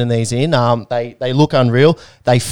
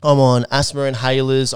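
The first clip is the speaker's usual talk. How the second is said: normal talking voice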